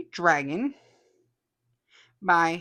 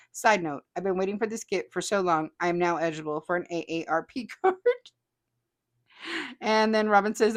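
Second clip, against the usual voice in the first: ordinary talk, nothing marked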